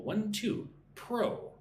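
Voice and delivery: counting up to pro, pro hit high; stress on second syllable. Shown as a demonstration. Teacher counting syllables to show stress, high on pro. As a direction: count one, two, then lift pro. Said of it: "put the emphasis on the second syllable".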